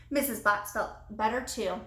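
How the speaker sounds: usual speaking voice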